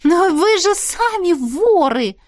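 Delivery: high, slow shocked cry; stunned exclamation. A narrator's reading. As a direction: blurt it out in disbelief. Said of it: "ошарашенно воскликнула я". (ошарашенно, с возгласом)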